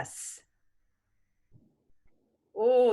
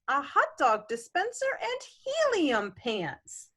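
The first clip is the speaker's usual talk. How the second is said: rhythmic picture-book reading; gentle bedtime-story reading; story narration; 3 semitones lower, 5 dB quieter than usual. A narrator's reading. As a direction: read the rhyme to a child. Parent continues the reading.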